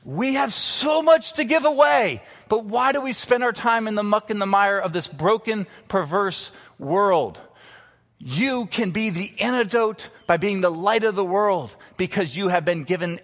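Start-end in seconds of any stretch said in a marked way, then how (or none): 0.0-10.1 high, loud preaching cry; a sudden rise to full preaching force; impassioned preaching. Real, not acted. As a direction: lift it up like a call from the pulpit. Preacher rises to impassioned peak.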